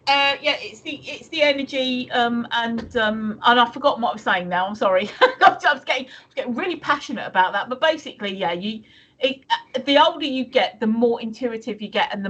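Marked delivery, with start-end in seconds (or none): none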